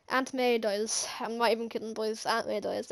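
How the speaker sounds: low, soft, downcast delivery; hushed, sinking disbelief at a loss; subdued grief. Real, not acted. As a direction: break the sad news quietly. Sad over character's death.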